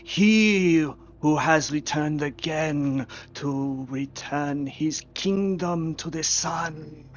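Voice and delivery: slow, booming proclamation of royal titles; pompous ancient herald, loud then subsiding; mummy herald. Acted. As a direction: announce your king with stretched, ceremonial grandeur.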